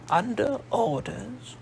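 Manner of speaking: put-on slow, high questioning old voice; doubting his story; sceptical old lady. Old Nene doubts the disguised midshipman, slow and high.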